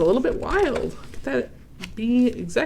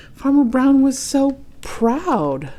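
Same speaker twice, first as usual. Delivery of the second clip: proud; 6 semitones higher, 3 dB louder, slower than usual. A narrator's reading. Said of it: "reader voices proud farmer".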